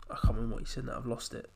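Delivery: usual speaking voice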